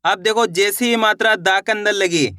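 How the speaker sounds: ordinary talk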